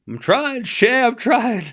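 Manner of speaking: a hammed-up plea from someone close to tears; mock tearful plea. Joking to a co-host that she may cry, a mock tearful plea.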